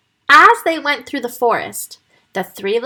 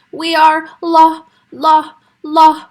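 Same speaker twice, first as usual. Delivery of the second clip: nervous, stuttering start, louder and higher; stammering; 6 semitones higher, 7 dB louder, slower than usual. Acted. Piglet stammering to the wolf, scared. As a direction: stumble over the word in fright. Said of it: "stammered Freddie".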